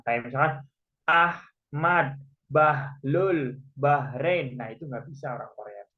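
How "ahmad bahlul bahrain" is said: "Ahmad, Bahlul, Bahrain" broken after each h; sounded middle H. Shown as a demonstration. Teacher lists words Koreans can't say, sounded middle H. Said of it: "membunyikan H yang ada di tengah".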